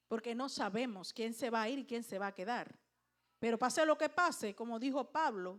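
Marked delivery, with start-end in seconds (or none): none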